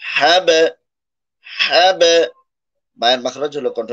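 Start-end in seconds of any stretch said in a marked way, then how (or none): 0.0-2.0 হ্যাব with the b bounced out like an echo; qalqala demo. Shown as a demonstration. Teacher shows students the echoing b. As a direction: pop the final b of হ্যাব. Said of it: "বায়ে সাকিন হলে কলকলা হয়".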